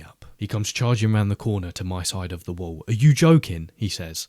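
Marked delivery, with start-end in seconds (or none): 2.9-3.7 loud, indignant challenge; narrated boss's irate outburst; angry disbelief. A narrator's reading. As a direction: confront the worker in a raised voice. Boss charges round after being hurt.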